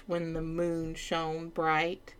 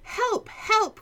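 high frantic cry for help; panicked page cry; 4 dB louder than usual. Acted. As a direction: yell for help, pitched way up. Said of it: "cried the page".

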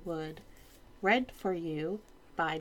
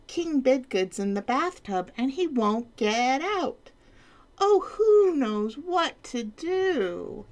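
higher fretting page voice; fretful page; 7 semitones higher, 4 dB louder than usual. Acted. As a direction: wring hands as the page. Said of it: "cried the page".

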